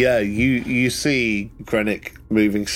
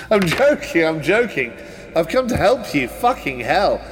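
high, laughing posh gent; 5 semitones higher than usual. Acted. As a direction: break into a jolly posh laugh-voice. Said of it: "He does that posh voice so well".

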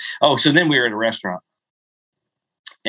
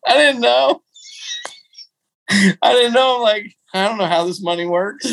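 laughing; 12 semitones higher than usual. Real, not acted. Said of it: "this is me being not very smart".

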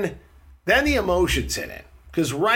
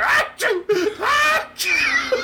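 mock sneezes; much slower than usual. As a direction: sneeze it out big, through laughter. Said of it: "the doctor has a sneezing fit".

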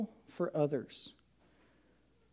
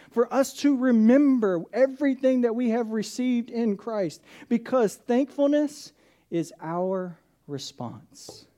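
higher, louder climactic preaching; preaching at full force; 5 semitones higher, 6 dB louder than usual. Acted. Preacher closes the sermon to his church, at full force. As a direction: pastor urges the congregation to return to overflow, preaching hard.

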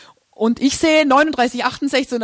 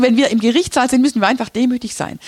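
ordinary talk

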